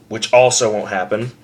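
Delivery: normal talking voice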